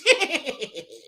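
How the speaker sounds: high ghoulish cackle; Crypt Keeper cackle. Retold or imitated. Streamer ends the impression with a high ghoulish cackle. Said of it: "the Crypt Keeper".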